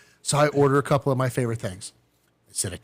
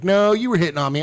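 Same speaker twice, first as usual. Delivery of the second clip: raised, higher accusing quote; mock accusation; 6 semitones higher, 6 dB louder than usual. Partner retold accusing him of flirting, mock accusation. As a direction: push it out louder, as if calling someone out.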